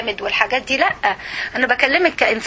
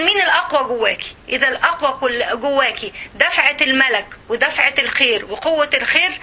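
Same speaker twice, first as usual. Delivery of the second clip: ordinary delivery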